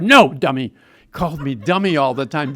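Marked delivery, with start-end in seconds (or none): 0.0-0.7 loud, scornful rebuke; a mock loud scolding; gruff scolding. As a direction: bark it down at the kid. Father figure scolding him.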